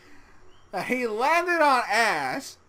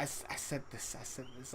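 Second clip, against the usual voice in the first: whisper; 6 semitones lower, 18 dB quieter than usual. Real, not acted. Whispered aside about past remark.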